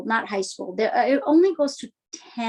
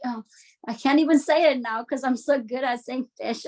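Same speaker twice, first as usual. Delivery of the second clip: ordinary talk, nothing marked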